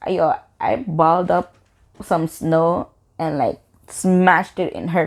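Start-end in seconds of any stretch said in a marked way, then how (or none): none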